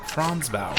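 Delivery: usual speaking voice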